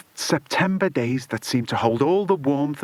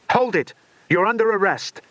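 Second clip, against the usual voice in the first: high, loud order to freeze; commanding shout; 7 semitones higher, 3 dB louder than usual. A narrator's reading. Policeman Bill stopping the villain, commanding shout. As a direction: bark the arrest with authority.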